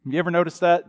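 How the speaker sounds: ordinary delivery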